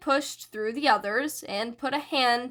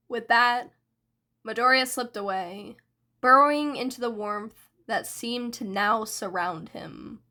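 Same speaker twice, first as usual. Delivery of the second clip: ordinary delivery, nothing marked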